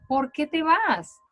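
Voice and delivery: question, rising high and slow; asking tone. Shown as a demonstration. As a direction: read it as a real question to the girl. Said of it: "Le pregunta".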